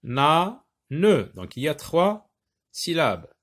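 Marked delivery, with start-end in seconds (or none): 0.0-1.3 ba, na, ne as separate beats; louder, detached syllables. Shown as a demonstration. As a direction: break the word into ba - na - ne with pauses. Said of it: "Donc, on va dire".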